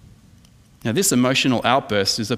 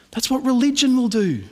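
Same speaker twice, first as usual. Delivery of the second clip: usual speaking voice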